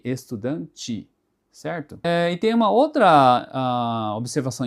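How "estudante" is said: "estudante" slow, ending estudanchi; final E as I. Shown as a demonstration. Teacher shows the ending for students, slow final I sound. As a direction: stretch "estudante", end on an I sound. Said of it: "com som de I".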